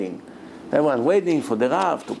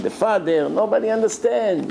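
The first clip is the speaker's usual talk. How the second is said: ordinary talk, nothing marked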